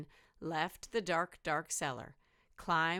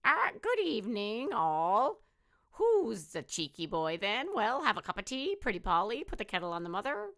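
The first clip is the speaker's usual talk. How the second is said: higher, louder squawky parrot voice; 5 semitones higher, 4 dB louder than usual. Acted. Parrot skeleton greets the visitors, high and louder. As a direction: play a chatty cheeky parrot.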